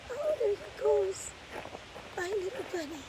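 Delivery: high cooing farewell to a bunny. Real, not acted. Bunny runs off, cooing goodbye.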